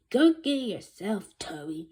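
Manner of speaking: loud retort; shouting back. Acted. Secretary refusing the principal, loud shout back. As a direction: snap it right back at him. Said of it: "Miss Ambrose shouted back".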